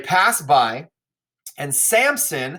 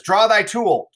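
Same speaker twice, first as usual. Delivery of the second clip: fight cry; 7 semitones higher, 5 dB louder than usual. Acted. Acted line of a sword fight.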